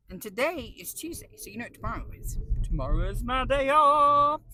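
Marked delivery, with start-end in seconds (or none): 2.6-4.4 loud, thrilled announcement; excited. Real, not acted. Vlogger telling viewers about her day off, thrilled announcement.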